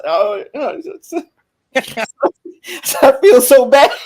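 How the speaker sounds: high, blubbering imitation of himself in tears; mock crying. Uncle confesses guilt to his brother, exaggerated tearful voice. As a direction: wail it out, hammed-up and half laughing. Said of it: "What you crying for".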